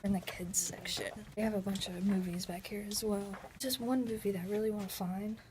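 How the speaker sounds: hushed whisper so nobody is disturbed; library whisper. Real, not acted. Vlogging inside the library to viewers, whispering. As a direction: keep it breathy and quiet like among the shelves.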